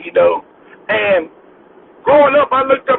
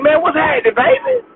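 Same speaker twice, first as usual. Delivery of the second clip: casual slang greeting; 10 semitones higher than usual. Retold or imitated. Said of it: "I be like".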